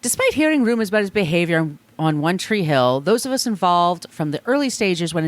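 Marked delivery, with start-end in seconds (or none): none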